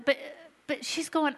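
ordinary talk

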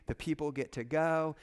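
normal talking voice

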